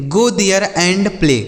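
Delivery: each word said slowly and apart; slow clear example. Shown as a demonstration. Teacher reading an example sentence slowly to children. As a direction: spell the sentence out slowly for the kids. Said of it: "जैसे".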